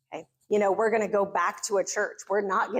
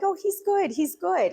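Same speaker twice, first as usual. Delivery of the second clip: polite brush-off; 9 semitones higher than usual. Retold or imitated. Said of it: "And we're like".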